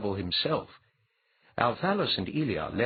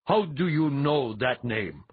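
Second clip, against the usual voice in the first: loud, slow, high challenge; shocked demand; 6 semitones higher, 5 dB louder, slower than usual. A narrator's reading. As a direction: demand it, startled and indignant. Shocked priest demands an answer.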